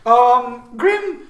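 high, loud cartoon announcer with laughs; booming gleeful announcer; loudspeaker announcer voice. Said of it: "you hear over the loudspeaker say".

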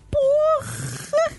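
high strangled cry of pain; pained yelp. Retold or imitated. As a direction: yelp as the smoke burns. Choking on cigarette smoke.

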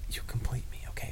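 whispered line cue; voiceless whispered prompt. Pretend. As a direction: whisper the movie line softly.

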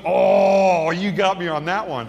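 loud drawn-out groan of defeat, easing off; exaggerated concession. Atheist loses the argument to the pastor, mock groaning concession.

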